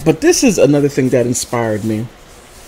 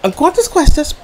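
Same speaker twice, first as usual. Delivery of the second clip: higher, careful Spanish line; imitating mom's Spanish; 11 semitones higher than usual. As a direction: ask the clerk politely in Spanish. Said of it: "she goes".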